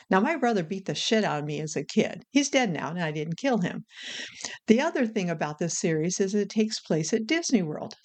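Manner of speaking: normal talking voice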